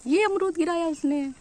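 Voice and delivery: loud, high-pitched outburst; excited exclamation. Real, not acted. Vlogger sees the parrot drop a guava, excited exclamation. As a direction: exclaim it loudly, pitched right up.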